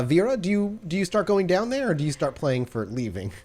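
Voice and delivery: ordinary talk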